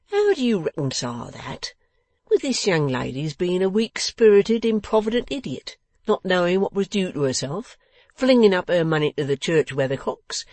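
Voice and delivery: loud sarcastic tirade, pitch jumping up; indignant scornful employer. Acted. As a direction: rant at him with biting sarcasm.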